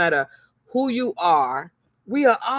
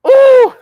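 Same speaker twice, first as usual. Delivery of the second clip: shrill shocked ooh; 15 dB louder than usual. Real, not acted. Reaction to packages refused.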